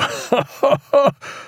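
high, acted chuckle; high staccato ha-ha of delight; captain's laugh. A narrator's reading. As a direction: laugh heartily in character.

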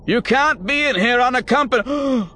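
high, loud rebuke; indignant shout. A narrator's reading. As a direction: yell at the intruders. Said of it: "he began".

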